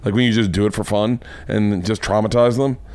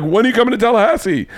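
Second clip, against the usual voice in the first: eager fan; 9 semitones higher, faster than usual. Retold or imitated. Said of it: "And they're like".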